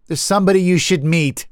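high, quick call. A narrator's reading. Harriet to the narrator below, high calling voice. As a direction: call it down from a height.

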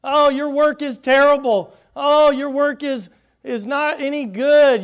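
high, loud jeering taunt; ridicule. Acted. Mocker ridiculing the workers, loud high jeering. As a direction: sneer down at them, loud and high. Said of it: "here comes the ridicule".

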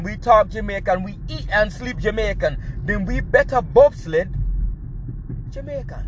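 put-on quoting Sanka in a mild Jamaican accent. Quoting Sanka from Cool Runnings, mild Jamaican accent. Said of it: "Sanka said".

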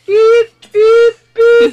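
high, loud reversing-truck beeps; backing-up beep. Retold or imitated. Listener mocking a big guy backing off, high loud beeps.

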